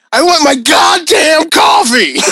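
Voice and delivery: slow shouted radio host rant; Casey Kasem. Retold or imitated. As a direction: shout it like an angry DJ. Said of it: "I kinda wanna do a Casey Kasem one day".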